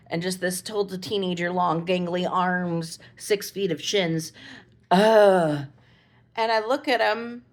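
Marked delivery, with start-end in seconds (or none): 4.9-5.7 frustrated groan. Retold or imitated. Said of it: "And he just goes".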